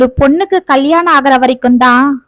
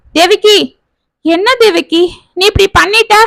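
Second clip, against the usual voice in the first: put-on higher, whimpering like a child to his wife; a grown man pleading like a little boy; lightly voiced childlike complaint; 6 semitones higher than usual. A narrator's reading. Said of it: "குழந்தையை போல்".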